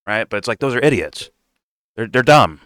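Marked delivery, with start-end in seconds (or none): none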